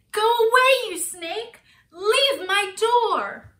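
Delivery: high, loud scared order; frightened Square. A narrator's reading. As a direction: shout the snake away in fear. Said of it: "Square was afraid of snakes".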